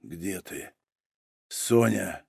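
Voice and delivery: soft, low, searching call; barely audible, calling for someone missing; whisper. A narrator's reading. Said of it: "прошептал он". (тихий слабый шёпот)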